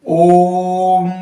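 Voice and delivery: ओम with the ओ held long; drawn-out Om. Shown as a demonstration. Teacher demonstrating the prolonged pluta vowel, slow and held. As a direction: stretch the ओ of ओम like a chant. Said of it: "ओ को हमने बहुत ज्यादा समय तक बोला".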